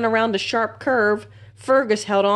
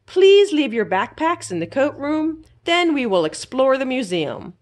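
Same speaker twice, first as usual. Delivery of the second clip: higher, louder guide announcing; tour guide; 6 semitones higher, 3 dB louder than usual. A narrator's reading. Tour guide instructing the class, bright announcing. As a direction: address the group like a guide. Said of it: "a tour guide said".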